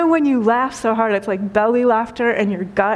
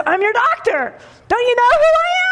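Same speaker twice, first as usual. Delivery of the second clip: much higher, rising outraged demand; hammed-up wounded pride, voice climbing; indignant doctor; 13 semitones higher than usual. A doctor insisting to his patient that he matters, hammed-up indignant squeak.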